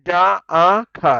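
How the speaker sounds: ডা আ খা drawn out very slowly, syllable by syllable; teacher breaking ডাক into sounds; spelling out sounds. Shown as a demonstration. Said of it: "এই যে".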